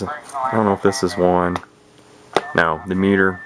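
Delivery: ordinary delivery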